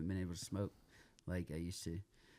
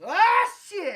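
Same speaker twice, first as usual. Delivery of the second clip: sudden, very high shouted sneeze; cuss-word sneeze; 16 dB louder than usual. Retold or imitated. Pretend sneeze at Texas Roadhouse, loud cussing blast. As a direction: explode into the curse mid-sneeze. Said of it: "always a cuss word and a sneeze".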